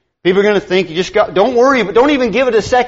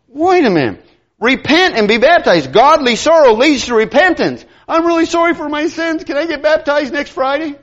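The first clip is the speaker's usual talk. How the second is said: higher, mocking the casual repentant; mock sinner's request; 8 semitones higher than usual. Mocking someone who books baptism for Friday. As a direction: lampoon a too-casual convert. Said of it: "I'm sitting there going".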